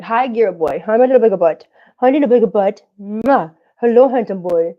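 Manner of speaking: loud, cooing sing-song to the cat; doting pet talk. Real, not acted. Greeting her cat fondly.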